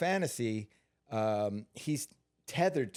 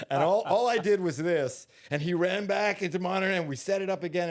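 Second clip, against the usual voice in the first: laughing loudly; 8 semitones higher, 7 dB louder than usual. Real, not acted. Laughing while telling the stunt story.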